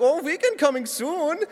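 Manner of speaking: high, excitable mock German accent; German Willy Wonka. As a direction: gush as the German waiter. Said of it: "like, a German Willy Wonka".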